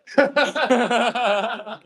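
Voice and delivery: high, bursting laugh at the absurdity; real laugh. Real, not acted. Laughing at himself for doubting the caller. Laughing at his own disbelief.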